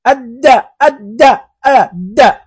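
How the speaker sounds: أد said slowly with the doubled د pressed hard; heavy doubled dal. Shown as a demonstration. Teacher showing pupils the heavy shadda sound. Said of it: "هذا ثقيل".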